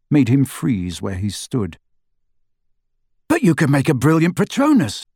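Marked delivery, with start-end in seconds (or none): 3.3-5.0 protesting. A narrator's reading. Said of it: "protested Ron".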